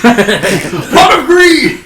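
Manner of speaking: high, loud, drawn-out card call; hammed-up anime attack shout; mock battle cry. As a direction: bellow it like an anime duelist.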